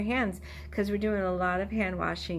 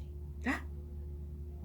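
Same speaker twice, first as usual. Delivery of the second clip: gasp; 15 dB quieter than usual. Shown as a demonstration. Mock surprise in song.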